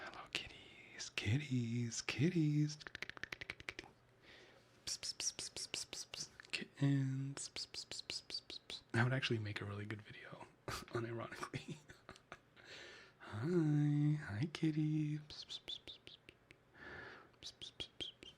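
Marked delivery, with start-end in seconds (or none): 0.0-1.1 cooing whisper to cats. Real, not acted. Calling viewer's cats. 1.1-2.8 sing-song call to the kitties; higher louder cat call. Real, not acted. Calling viewer's cats. 4.9-8.8 whispered psst cat call. Real, not acted. Calling cats with psst. 13.4-15.2 higher, louder whispered kitty greeting. Real, not acted. Greeting cats.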